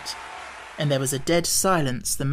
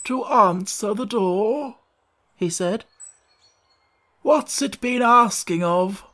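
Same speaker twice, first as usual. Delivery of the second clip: put-on hoarse old frog; 5 semitones higher, slower than usual. A narrator's reading. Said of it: "He was so hoarse".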